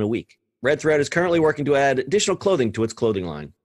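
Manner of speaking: brisk, lifted scripted news delivery; crisp podcast news report; news read. Acted. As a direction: read it like a retail news bulletin. Host reads the scripted news story.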